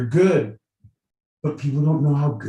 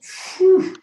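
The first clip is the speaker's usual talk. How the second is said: sharp, airy "phew" sound effect; voiceless burst of air for comic emphasis; imitated whoosh; 5 dB louder than usual. Describing a friend's huge Adam's apple, imitated whoosh.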